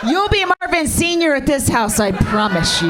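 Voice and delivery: half-shouted high plea; mock begging shout. Mother pleading with son's friend to stay, eager shout. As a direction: overeager promise called out to the boy.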